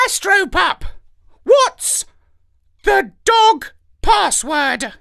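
cocky parrot character voice. A narrator's reading. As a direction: play the sly parrot.

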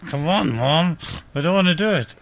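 a lightly voiced whining son; a hint of a whiny kid talking to his mom. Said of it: "I'm like".

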